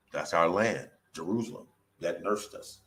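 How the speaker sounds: ordinary delivery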